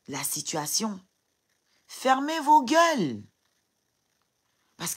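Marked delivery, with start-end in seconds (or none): 1.9-3.2 much higher and louder, slow and hammered; real enraged shout; furious shout. Real, not acted. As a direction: scream it at the critics. Telling commenters to shut up.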